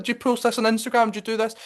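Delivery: high, fast mock-curious questions; eager questioning. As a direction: pepper him with high-pitched questions. Acted questioning of the camera guy.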